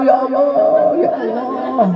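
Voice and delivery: high-pitched mock pleading to God; wailing supplication. Shown as a demonstration. Preacher shows listeners how to cry out to Allah, wailing supplication. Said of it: "Munajat sama Allah".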